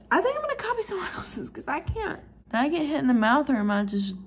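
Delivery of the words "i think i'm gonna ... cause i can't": high, giggly tipsy voice. Real, not acted.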